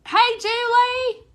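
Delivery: high, slow, drawn-out goofy greeting; silly put-on voice. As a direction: stretch it out in a squeaky joke voice. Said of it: "we would both look at each other and go".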